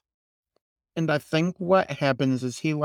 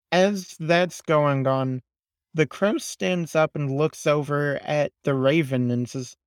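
ordinary talk, nothing marked

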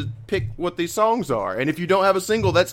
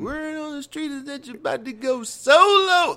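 quoting a line; 9 semitones higher than usual. Retold or imitated. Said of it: "oh, that's so good".

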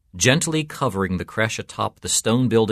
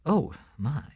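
soft, higher "oh, my"; quiet dismay; 6 semitones higher, 5 dB quieter than usual. A narrator's reading. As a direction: let it out softly, laughter gone. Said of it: "The priest stopped laughing".

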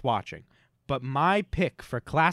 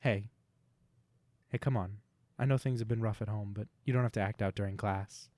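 a hint of a gentle teacher, softer and lower; mock teacher; 6 semitones lower, 4 dB quieter than usual. Host scolding the heckling co-host like a teacher, soft and patient. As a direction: talk down kindly like a teacher to a pupil.

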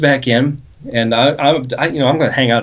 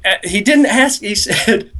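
normal talking voice

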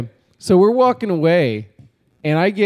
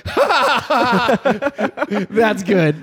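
mock laugh; 6 semitones higher, much slower than usual. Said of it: "He just laughs".

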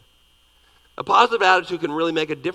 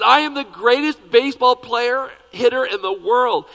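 put-on loud, higher, bragging little boy; boastful little boy; 8 semitones higher, 9 dB louder than usual. Little boy with a bat brags to himself, loud. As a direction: shout it out like a cocky kid. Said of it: "He says".

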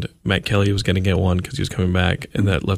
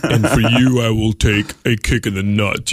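booming God voice. Pretend. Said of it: "God was like".

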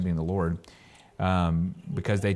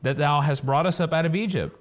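raised, higher pitched grumbling; complaining crowd; 6 semitones higher than usual. Acted. The thirsty people griping at Moses, raised and complaining. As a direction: whine it at Moses like a parched crowd. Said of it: "the people murmured against Moses and said".

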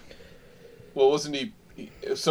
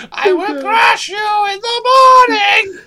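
much higher, loud shrieking threat; screeching villain threat; 8 dB louder than usual. Acted. Villain voice joke.

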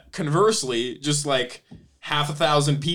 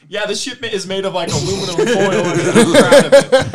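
higher, loud boastful bravado; cocky CEO voice; 6 semitones higher, 10 dB louder than usual. Retold or imitated. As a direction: brag like a reckless tycoon. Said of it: "this guy being like".

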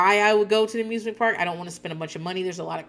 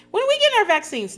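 put-on high, louder kid question; voicing the kids; 6 dB louder, faster than usual. Kids asking mom about their vaccines, high and eager. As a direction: pipe it up like a child.

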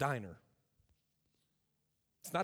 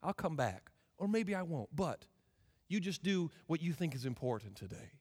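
gentle, kind; 2 semitones lower, 5 dB quieter than usual. Acted. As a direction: act out a gentleman quietly withdrawing. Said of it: "He's gentle. He's kind. He'll say".

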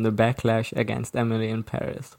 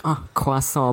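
mock French accent; slower than usual. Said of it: "Everyone is gonna be like".

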